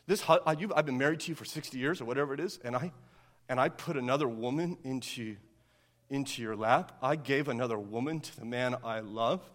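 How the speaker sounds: low, soft, wounded pleading; hurt wife. Acted. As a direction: quietly let the pain show, halting. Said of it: "I want you to feel her pain".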